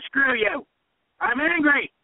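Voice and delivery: high-pitched, emphatic indignation; defiant angry outburst. Acted. Host rejecting the typical politician's answer, indignantly angry. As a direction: throw off the polite answer and challenge everyone.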